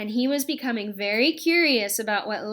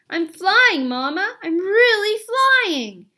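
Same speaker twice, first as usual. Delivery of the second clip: high, loud cry of joy; joyful shout; 8 semitones higher, 8 dB louder, slower than usual. A narrator's reading. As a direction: shout with pure delight. Gosling flies, calls to mother.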